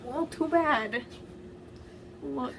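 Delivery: usual speaking voice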